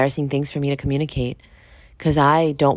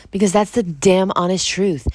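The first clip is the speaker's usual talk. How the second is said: ordinary delivery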